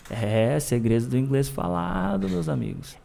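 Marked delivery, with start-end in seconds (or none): none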